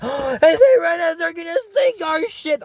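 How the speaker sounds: high-pitched mock panic; mock alarm. Kings fearing Serenas will sink their ship, mock panic. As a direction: squeal it out in pretend alarm.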